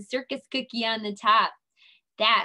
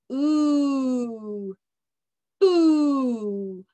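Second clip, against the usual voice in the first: "ooh" then "boo" blended, louder; sounding out; 5 dB louder than usual. Shown as a demonstration. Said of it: "let's read this".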